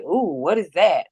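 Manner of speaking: slow mock surprise, swooping up and down on OOH; imitated surprise. Imitating the cop looking in the cup, mock surprise. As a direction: imitate him drawing out a wondering "ooh". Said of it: "He was like".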